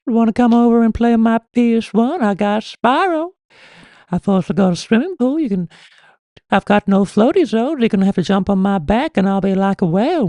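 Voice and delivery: put-on very high, creepy old man voice; high cartoon old-man impression, words stretched out; Herbert the Pervert. Creepy old neighbour inviting little Chris over to play, high old man voice. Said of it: "is it Herbert the Pervert from Family Guy?".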